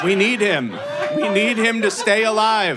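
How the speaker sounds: high, loud rallying cry; impassioned mock plea. Group begging to keep a man alive, hammed-up plea. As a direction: plead loudly for his life, hammed-up. Acted group pleading for him.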